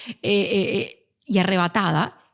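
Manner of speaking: ordinary talk, nothing marked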